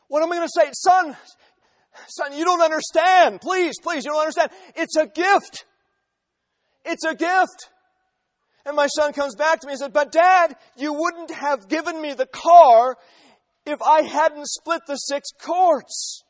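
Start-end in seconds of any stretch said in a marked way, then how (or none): 0.7-7.7 higher, louder earnest plea; pleading father. Pretend. Father pleading the gift is free. 9.9-16.2 louder, slowed insistent objection; insistent son. Pretend. Son arguing he earned it.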